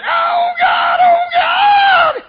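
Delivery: high, loud wail of a woman at the altar; wailing jilted woman. Retold or imitated. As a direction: cry out to God in despair. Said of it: "Got down the altar".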